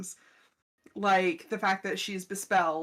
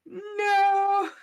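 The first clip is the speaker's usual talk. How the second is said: high, loud flat refusal; mock grumpy no; 12 semitones higher, 8 dB louder than usual. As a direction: snap out a stubborn no. Mimicking a grumpy denial.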